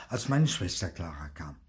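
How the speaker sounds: usual speaking voice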